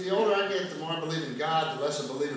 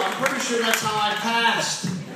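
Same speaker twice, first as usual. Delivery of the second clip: normal talking voice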